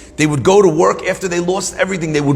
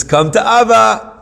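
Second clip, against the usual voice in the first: put-on calling a toddler; 7 semitones higher, 4 dB louder than usual. Father calls toddler again, backing away.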